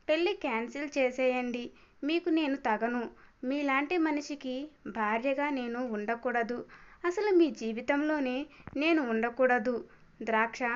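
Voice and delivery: plain reading voice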